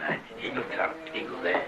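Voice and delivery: usual speaking voice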